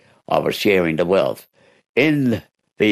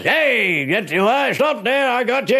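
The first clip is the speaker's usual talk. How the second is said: retold yell, pitched way up; bloke yelling out. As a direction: shout him down like a startled homeowner. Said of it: "I heard the bloke yell out".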